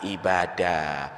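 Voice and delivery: low, soft, slow enumeration of deeds; soft, slow sermon listing. Real, not acted. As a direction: list them slowly and softly, like a calm exhortation. Slow quiet listing of worship. (menyebutkan amalan dengan pelan, rendah dan lirih)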